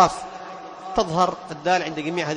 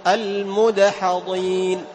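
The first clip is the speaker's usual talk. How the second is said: slow drawn-out chant; Quran recitation; 3 semitones higher, much slower than usual. Shown as a demonstration. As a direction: stretch المدحضين like a reciter. Recites word example. (تلاوة متمهلة)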